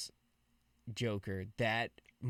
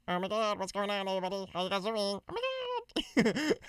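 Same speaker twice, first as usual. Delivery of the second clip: squeaky-high fake effect made with the throat; high-pitched voice changer; 8 semitones higher than usual. Shown as a demonstration. Streamer mimicking a soundboard voice changer, high-pitched. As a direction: push the voice way up high, drawing out the end. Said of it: "it could do a high pitch one".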